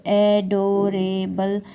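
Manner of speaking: "Adorable" slowly split into four parts; syllable by syllable. Shown as a demonstration. Teacher dividing a word for her viewers, syllable by syllable. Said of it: "four parts में इसको divide करेंगे".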